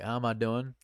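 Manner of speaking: normal talking voice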